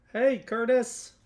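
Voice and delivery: high, loud, drawn-out hail; accidental Kermit the Frog. Real, not acted. Painter greeting a viewer who joins the stream, Kermit-like. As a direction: cry out a greeting like an excited puppet frog. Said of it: "I sounded like Kermit the Frog".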